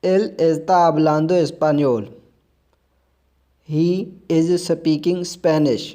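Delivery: very slow, careful reading for learners; slow model sentence. Shown as a demonstration. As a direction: lay each word down slowly. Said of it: "आगे है".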